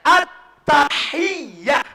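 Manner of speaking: at-tahiyyah with short final, no t; drawn-out wrong at-tahiyyah; wrong pronunciation. Shown as a demonstration. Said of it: "Bukan at-tahiyya". (pelafalan salah)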